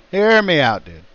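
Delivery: laughing insistent plea. Real, not acted. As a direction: push to be heard, laughing. Insisting, laughing, over co-host.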